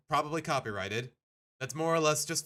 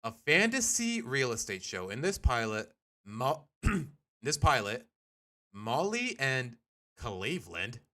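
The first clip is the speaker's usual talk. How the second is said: normal talking voice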